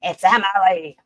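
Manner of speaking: loud mock growl of her line. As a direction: bark the name like a growl. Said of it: "a growl".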